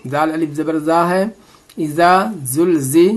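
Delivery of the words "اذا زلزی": slow "اذا زلزی" with a clear z, never j; za sound, not jeem. Shown as a demonstration. Teacher correcting learners, "اذا زلزی" slowly with a clear z. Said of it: "یہاں پر زا کی آواز نکالنی ہے".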